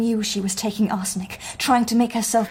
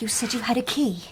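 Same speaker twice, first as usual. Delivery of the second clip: a soft, careful whisper; 6 dB quieter than usual. Acted. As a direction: slip him the reminder quietly. Gently prompting the raving man.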